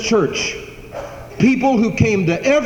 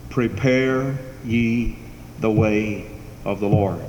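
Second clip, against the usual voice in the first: very slow, drawn-out intoning; intoned scripture; 9 semitones lower, 5 dB quieter, slower than usual. Acted. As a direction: stretch each phrase like a proclamation. Closing intoned quote.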